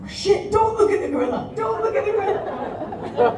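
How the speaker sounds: high, loud, laughing panic; panicked. Retold or imitated. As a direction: panic at yourself, half laughing. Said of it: "I'm like".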